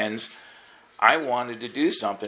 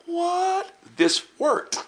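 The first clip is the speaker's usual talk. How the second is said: surprised what; much slower than usual. Retold or imitated. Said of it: "I went".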